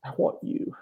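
whispered, voice breaking with emotion; tearful whisper. Real, not acted. Answering Jesus in his retold vision, tearful whisper. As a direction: barely get it out through tears. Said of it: "I'm getting emotional now".

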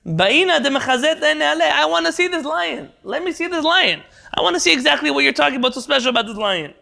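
a hint of a pushy ruler demanding to be shown; teacher acting the Caesar's challenge, mildly demanding. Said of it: "says the Caesar".

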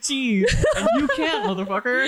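high, gloating taunt through laughter; mock proud. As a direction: imitate a proud man rubbing it in, cracking up. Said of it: "He was so proud".

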